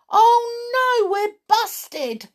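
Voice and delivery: high panicked cry of dismay; a kid caught out, voice jumping up; alarmed boy. A narrator's reading. George realises they've been caught, high alarm. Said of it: "said George".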